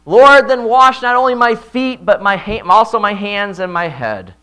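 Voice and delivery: loud, high, eager retold outcry; passionate cry. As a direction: beg with all your heart. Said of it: "passionately cries out".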